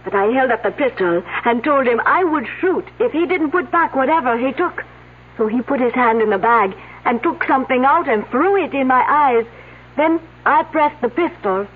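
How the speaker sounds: put-on light French accent throughout. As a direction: speak English with a French lilt. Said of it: "unless someone's imitating her accent".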